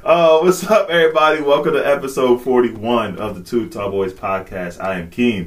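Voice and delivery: loud, hyped host welcome; podcast intro. Acted. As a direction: greet the listeners big and loud. Podcast opening.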